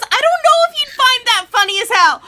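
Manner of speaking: fast, high, loud panicky rush; panicked outburst. Retold or imitated. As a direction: blurt it out in a panic. Panicked retold thought.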